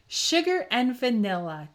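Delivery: usual speaking voice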